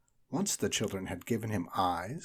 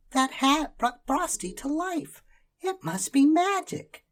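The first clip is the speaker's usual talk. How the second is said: much higher, louder, slow gasping wonder; amazed little girl; 7 dB louder than usual. A narrator's reading. Karen amazed as Frosty comes alive. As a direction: marvel at the magic. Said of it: "Karen gasped and said".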